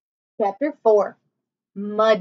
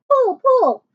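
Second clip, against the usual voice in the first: very high, louder urging; urgent cheering; 13 semitones higher, 6 dB louder than usual. Acted. Jack urging Annie to pull herself out, very high and louder.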